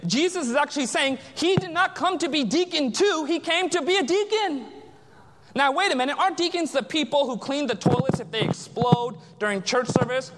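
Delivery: ordinary delivery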